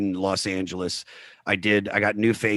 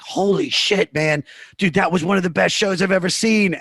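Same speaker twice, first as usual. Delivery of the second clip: higher, breathless fan raving; awestruck audience; 8 semitones higher than usual. Retold or imitated. Audience raving to the comics in the green room, excited. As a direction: gush like a stunned fan after a show.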